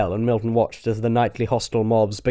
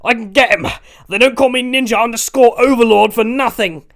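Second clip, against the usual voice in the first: much higher, louder boast; defiant boast; 12 semitones higher, 8 dB louder than usual. Acted. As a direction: shout back defiantly.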